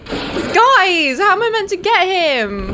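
high loud exasperated shouts; shouting at a boss and a teammate; frustrated yelling. Real, not acted. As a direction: yell in frustration. Fighting hard enemy, frustrated.

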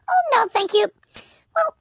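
ordinary talk, nothing marked

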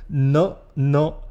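ordinary talk, nothing marked

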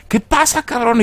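put-on the girl asking her dealer in a high alarmed voice; high frightened girl voice. Girl asks scared guy, panicking.

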